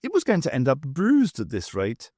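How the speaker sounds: plain reading voice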